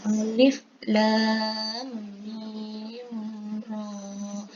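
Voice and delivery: alif lam mim ra drawn out slowly; Quranic letter-by-letter recitation. Shown as a demonstration. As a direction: hold lam, mim and ra evenly. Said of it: "Tadi kita lihat pada bacaan Ar-Ra'd ini".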